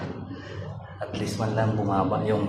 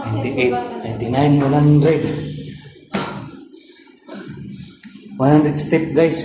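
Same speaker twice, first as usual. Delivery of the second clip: excited; 6 semitones higher, 7 dB louder than usual. Real, not acted. Finishing a hundred-step stair count.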